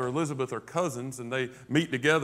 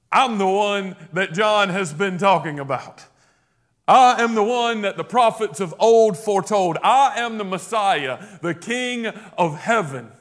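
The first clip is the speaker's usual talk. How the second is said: loud proclamation; 4 semitones higher, 6 dB louder than usual. Acted. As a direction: voice rising high and loud, slowing at the end. Jesus proclaimed, preacher voicing him.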